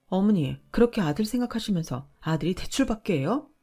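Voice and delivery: ordinary delivery, nothing marked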